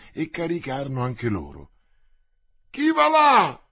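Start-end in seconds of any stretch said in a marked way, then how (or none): 2.7-3.6 high, loud sentry's challenge; shouted challenge. A narrator's reading. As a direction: cry it out sharply as a challenge. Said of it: "fu ripetuto".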